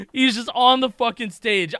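usual speaking voice

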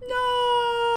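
high shocked no. Real, not acted. Gamer shocked by the prison news, high yelp. Gasps at in-game event.

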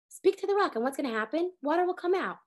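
normal talking voice

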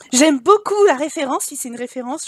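ordinary delivery

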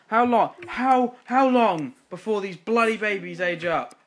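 high, loud exasperated how long; frustrated yell. Real, not acted. Player yelling in frustration at the babies. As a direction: vent at the game.

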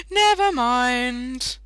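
loud high laugh, then a slow never mind; laughing off a silly mistake, drawn out. Real, not acted.

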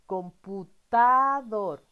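drawn out, loud and slow; slow stressed answer. Shown as a demonstration. Teacher to child, slow drawn-out answer word. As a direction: stretch the word out for children to hear.

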